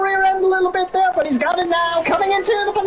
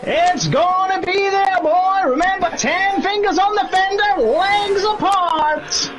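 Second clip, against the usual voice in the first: put-on Southern sheriff accent. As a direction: play a cartoon Southern sheriff. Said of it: "to get that accent".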